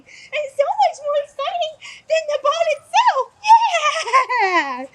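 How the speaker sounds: very high, loud hyped-up dog talk; out-excite the distraction, loud and high; squealing hype to grab a dog's attention; super exciting. Shown as a demonstration. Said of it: "I'm gonna be super exciting".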